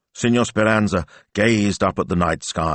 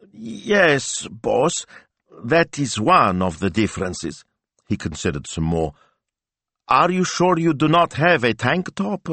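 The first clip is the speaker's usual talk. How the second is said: timid sidekick voice; 8 semitones higher than usual. A narrator's reading. Narrator voices Smilzo.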